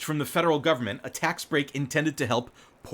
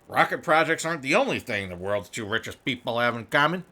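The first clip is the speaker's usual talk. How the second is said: put-on reading the news line in a light Chicago accent; a touch of Chicago newsreader; 2 semitones lower than usual. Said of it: "it's a Chicago accent".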